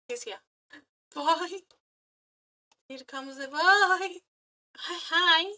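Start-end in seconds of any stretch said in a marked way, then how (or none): none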